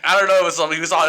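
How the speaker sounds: usual speaking voice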